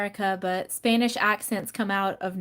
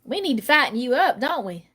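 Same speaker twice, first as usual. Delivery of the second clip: put-on fussing Southern lady with a lilting accent. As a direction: coax a guest to eat in a Southern accent. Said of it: "when a Southern lady is like".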